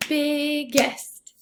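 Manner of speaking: "Biggest" stretched into two clear beats; syllable by syllable. Shown as a demonstration. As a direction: sound out "Biggest" as big, gest. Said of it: "That one has two syllables".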